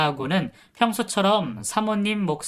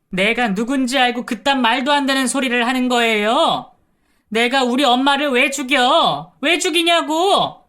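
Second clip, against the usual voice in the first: near-scream outburst; 7 semitones higher, 5 dB louder than usual. Retold or imitated. Caregiver revealing her mother, screaming outburst. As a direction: scream it out, losing all control. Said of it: "비명을 지르듯 외쳤습니다".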